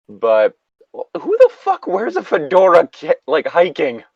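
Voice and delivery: high, laughing and loud; laughing disbelief. Real, not acted. Host laughing at the fedora with high, loud disbelief.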